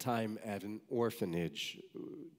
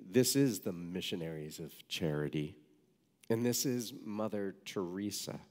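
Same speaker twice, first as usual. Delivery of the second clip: plain reading voice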